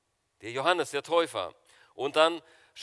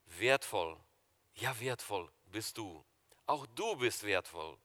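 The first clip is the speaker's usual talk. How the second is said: tender spoken blessing; 7 semitones lower, 7 dB quieter than usual. Acted. Song line repeated as personal blessing.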